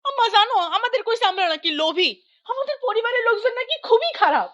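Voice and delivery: high-pitched, aggrieved tattling; indignant complaint. Acted. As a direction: report the insult to Mother, outraged. Indignant daughter reporting village gossip. (ক্ষোভে চড়া গলায় নালিশ)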